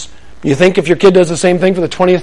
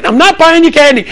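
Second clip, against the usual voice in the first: exasperated dad; 10 semitones higher, 3 dB louder than usual. Retold or imitated. Father refusing.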